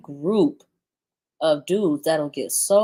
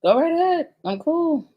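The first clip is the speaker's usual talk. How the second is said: dismissive; 10 semitones higher than usual. Real, not acted. Dismissive sign-off, high pitched.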